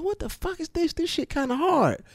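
high stunned reaction; retold amazement. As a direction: blown away by a new song. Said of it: "I was like".

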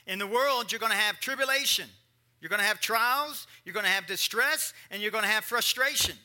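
loud, punchy sermon delivery; preaching at full force. Acted. Preacher warning the congregation, loud preaching. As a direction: preach it out, building each line.

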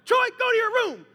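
high, loud mock-mom yell; angry mom. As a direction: order the kid off as a fed-up mom. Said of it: "Mom would be like".

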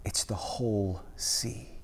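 soft, slow whisper of a revelation. Acted. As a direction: breathe the last words out. Jesus's quiet revelation.

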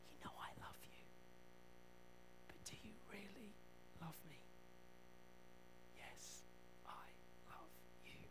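breathless whispered confession; soft whispered yes, I love you; hushed whisper. Retold or imitated. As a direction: whisper it like a broken man. Peter whispering love to Jesus.

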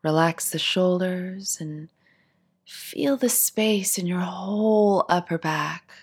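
calm, unhurried meditation voice with long pauses; guided meditation. Acted. Teacher guiding seated listeners through a body scan, guided meditation. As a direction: ease them gently through each instruction, leaving space to settle.

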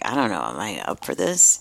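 understated nervous self-doubt; self-doubt quote. Retold or imitated. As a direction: voice your younger self's hesitation, low. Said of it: "I'm like".

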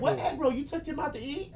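put-on high, suspicious girlfriend voice. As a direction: pitch up and question him. Voicing Chino's girlfriend.

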